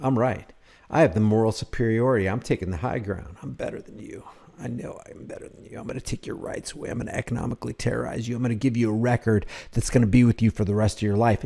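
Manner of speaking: hushed, quick mock smugness; Frank Sloop, self-righteous. Commentator voices officer's private thoughts, smug and very soft. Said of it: "Look at his, look at his mannerisms. He's like".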